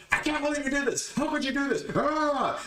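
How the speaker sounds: very high-pitched exaggerated fit; mock hysterical outburst. Showing the man who can't control his emotion, mock hysterics. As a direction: wail it in mock outrage, pitch shot way up. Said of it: "There's a total difference there".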